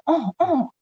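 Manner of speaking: mimicking absent-minded sympathy, high and soft; small, high, empty listening noises; soulless sympathy. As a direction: feign interest while half asleep. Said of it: "영혼 없이 듣는 거지".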